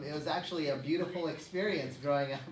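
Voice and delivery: usual speaking voice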